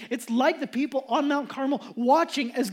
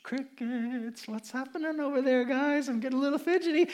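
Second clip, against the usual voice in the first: softer, teasing jeer at rivals; mocking taunt; 3 dB quieter than usual. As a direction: needle them with a smirk. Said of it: "He's mocking them".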